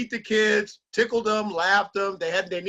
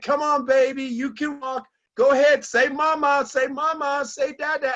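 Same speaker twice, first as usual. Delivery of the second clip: coaxing parent; 6 semitones higher, 4 dB louder than usual. Retold or imitated. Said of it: "and they're like".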